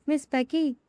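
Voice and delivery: usual speaking voice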